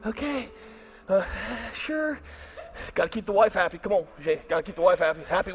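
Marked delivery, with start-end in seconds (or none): 0.1-2.2 reluctant okay. Retold or imitated. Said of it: "and I'm still".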